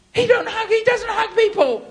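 very high, loud mock wail; imitated overwrought mum. Mum amazed her son hugs, high and loud. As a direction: squeal it in disbelief. Mum's astonished tearful protest.

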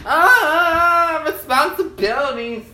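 loud, high groan at his chores; exasperated outcry. Real, not acted. Remembering the laundry he has to do, loud exasperated wail. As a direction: wail the word like a burden.

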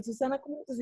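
soft, high-pitched solicitous greeting; imitated concern. Teacher recognizes her former student, softly concerned. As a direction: mimic a teacher gently checking in.

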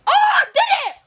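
high, loud victory cheer; triumphant shout. Real, not acted. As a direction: yell it out in triumph.

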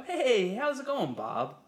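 overly cheerful hello; same fake cheery greeting. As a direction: greet him with forced cheer. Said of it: "same voice".

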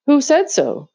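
a sister challenging her brother; indignant challenge. A narrator's reading.

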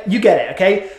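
ordinary talk, nothing marked

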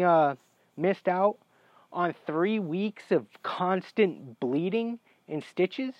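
high-pitched indignant rant; outraged disbelief. Retold or imitated. Patient learning his surgery was avoidable, outraged disbelief.

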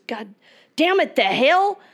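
high-pitched acted frustrated curse; hammed-up fed-up outburst; mock exasperation. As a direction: swear in exasperation, played for a joke. Frustrated owner swears in joke.

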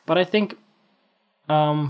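ordinary talk

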